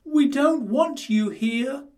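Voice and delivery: high, slow piglet voice. A narrator's reading. Quintin telling the wolf to leave, high piglet voice. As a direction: keep it high and pleading like a little pig. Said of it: "cried Quintin".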